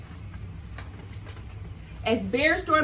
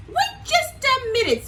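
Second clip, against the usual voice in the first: much higher, squeaky animal voice; Zebra voice; 13 semitones higher, 4 dB louder than usual. Acted. Zebra to the friends, high squeaky voice. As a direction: pipe up like a bright little zebra. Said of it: "said Zebra".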